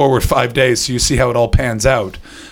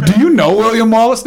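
high, loud eager question; excited fanboy; 10 semitones higher, 5 dB louder than usual. Pretend. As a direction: gush like a starstruck tourist. Mocking Scots fanboy question.

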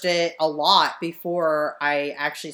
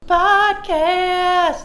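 high loud sung "Podcast" intro; loud high podcast jingle call; 14 semitones higher, 8 dB louder than usual. Pretend. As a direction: belt it like a show jingle.

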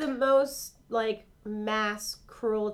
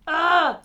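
loud exasperated ugh; wordless grunt of exasperation, raised in pitch; frustrated groan; 4 semitones higher, 7 dB louder than usual. Real, not acted. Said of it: "It's so frustrating".

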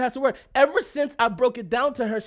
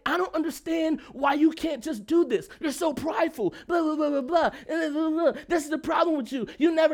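higher, imitating a nagging wife; lightly voiced wife going on and on; 4 semitones higher than usual. Husband mimics his wife nagging, higher and whiny.

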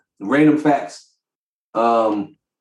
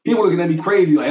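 usual speaking voice

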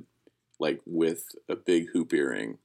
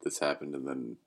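mock solemn; 4 semitones lower, 4 dB quieter than usual. Mock-solemn retelling of meme.

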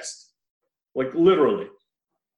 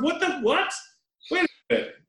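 much higher pitch, baffled what; pitched-up double take at the screen; baffled disbelief; 11 semitones higher, faster than usual. Retold or imitated. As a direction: jump up high in total disbelief. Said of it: "I was like".

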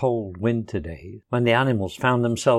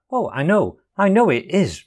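evasive; 6 semitones higher, 4 dB louder than usual. A narrator's reading. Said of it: "replied the Rat evasively".

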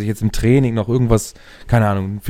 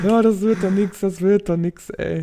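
laughing despair; 8 semitones higher than usual. Real, not acted. Laughing pessimism.

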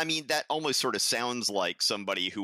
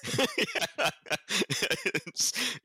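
rising burst of laughter; amused high laugh; 11 semitones higher than usual. Real, not acted. Laughing at Peter's pettiness.

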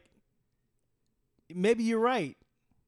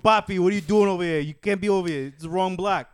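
put-on loud, higher Puerto Rican New York accent; mock Puerto Rican New Yorker; 5 semitones higher, 14 dB louder than usual. Warning Papi off the block, Puerto Rican New York accent. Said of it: "Puerto Rican".

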